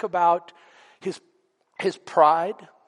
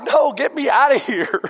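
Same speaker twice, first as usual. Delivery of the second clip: raised, higher mock-panicked protest; loud alarmed refusal; 6 semitones higher, 4 dB louder than usual. Winner answering his eager brother, loud alarmed refusal. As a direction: protest loudly, wanting out.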